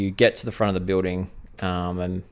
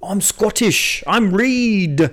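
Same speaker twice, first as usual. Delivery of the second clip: high, slow put-on Scottish accent; George Reed Scottish voice; 9 semitones higher, much slower than usual. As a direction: drawl the Scottish accent, proud. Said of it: "you gotta work on your voices".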